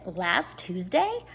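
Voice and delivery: ordinary delivery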